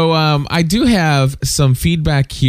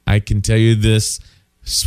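ordinary talk, nothing marked

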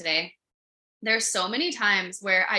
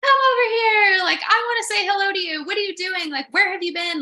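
high, loud, eager voice for the horse; a horse bursting to say hello; 11 semitones higher, 7 dB louder than usual. Retold or imitated. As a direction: voice the horse calling you over happily. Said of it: "And he was like".

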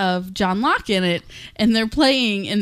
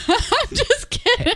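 laughing through the words; slower than usual. Real, not acted. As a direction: giggle out the line. Teasing about the lawsuit.

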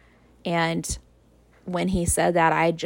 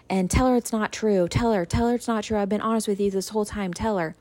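higher, urgent imitation of a pleading mother; panicking mom; 4 semitones higher than usual. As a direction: plead like a flustered mom on the phone, retold. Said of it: "she was panicking".

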